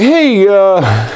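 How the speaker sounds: high, hopeful butting in; imitating himself. He asks Ron and Chevy to let him join, pitched high. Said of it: "and then I'm like".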